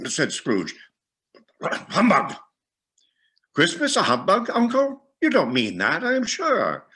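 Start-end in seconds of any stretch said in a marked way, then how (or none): 1.6-2.4 scornful humbug. A narrator's reading. Scrooge's scornful retort. 3.5-6.9 put-on much higher, incredulous young nephew; nephew's voice. A narrator's reading.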